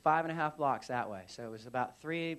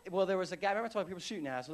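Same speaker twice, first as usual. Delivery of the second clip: plain reading voice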